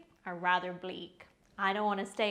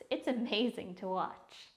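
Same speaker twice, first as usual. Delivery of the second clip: usual speaking voice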